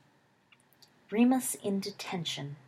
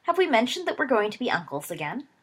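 bright; 6 semitones higher, 5 dB louder, faster than usual. A narrator's reading. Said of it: "said Gideon brightly".

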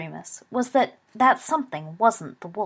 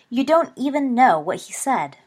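higher, louder protest; a touch shrill; 6 semitones higher, 7 dB louder than usual. A narrator's reading. Lily protests to Remus, a touch shrilly. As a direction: object in a slightly shrill, raised voice. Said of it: "a touch shrilly".

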